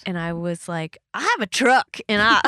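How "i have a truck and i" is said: loud, raised-pitch protest; defiant. Retold or imitated. Retelling her answer to mother-in-law's expectations, loudly defiant. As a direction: declare it proudly and loudly.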